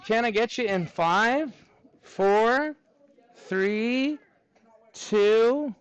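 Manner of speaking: usual speaking voice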